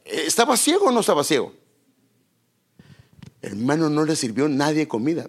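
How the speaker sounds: normal talking voice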